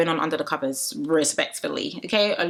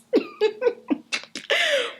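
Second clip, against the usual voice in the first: high, bursting real laugh; burst of laughter. Real, not acted. Laughing at the side eye.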